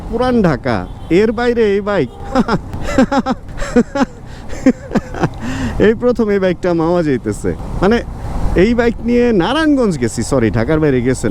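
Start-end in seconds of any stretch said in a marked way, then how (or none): 2.1-5.8 long, high-pitched laugh; real laugh in drawn-out bursts; amused laugh. Real, not acted. Laughing at his untravelled bike. (লম্বা হাসি)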